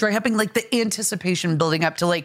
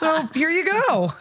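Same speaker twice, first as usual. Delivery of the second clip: laughing; 8 semitones higher, slower than usual. Retold or imitated. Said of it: "and be like".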